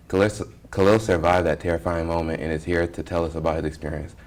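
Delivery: measured, soft news-read delivery; hushed dramatic news lead-in; news anchor intro. Acted. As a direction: set the scene like a TV reporter. Anchor opens the news segment.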